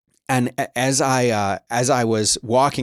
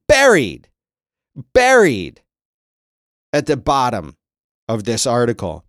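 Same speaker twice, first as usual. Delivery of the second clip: outraged emphasis; 6 dB louder than usual. Real, not acted. Host tells viewers the quote is hidden, outraged shout. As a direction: slam the word Buried in disbelief.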